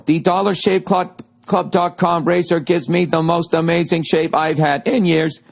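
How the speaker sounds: slow, deliberate ad read; slow it right down. Shown as a demonstration. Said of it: "I'm gonna go slower".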